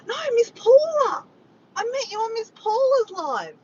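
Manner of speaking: high, loud gasping recollection; high-pitched, delighted correction; excited realisation. Real, not acted. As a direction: cry it out as it suddenly comes back to you. Suddenly remembers where they met.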